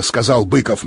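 plain reading voice

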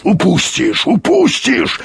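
high, frantic growling shout; growled cry. A narrator's reading. Explorer urges the pilot not to lose it, growling. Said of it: "рычал Юрковский".

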